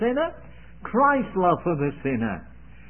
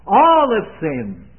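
emphatic proclamation; 3 semitones higher, 8 dB louder, slower than usual. Acted. Preacher quoting scripture forcefully.

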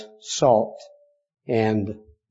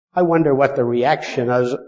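normal talking voice

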